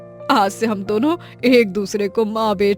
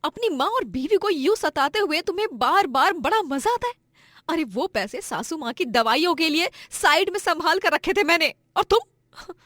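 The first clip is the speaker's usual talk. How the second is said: higher-pitched, hurt wife's reproach; indignant scolding; 8 semitones higher, 6 dB louder than usual. Acted. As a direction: scold the husband, upset and accusing. Wife confronts thieving drunk husband.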